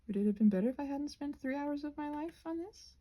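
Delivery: ordinary talk, nothing marked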